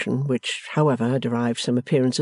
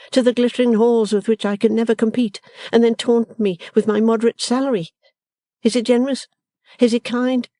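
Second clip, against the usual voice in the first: dismal lament; 9 semitones higher, 4 dB louder than usual. Acted. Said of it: "in a dismal vein".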